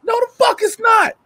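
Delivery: high-pitched comic outburst; worked-up protest. Retold or imitated. Acted outburst during the workout.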